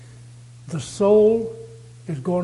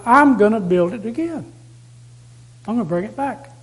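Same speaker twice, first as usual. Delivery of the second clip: confident; 3 semitones higher, 4 dB louder than usual. Retold or imitated.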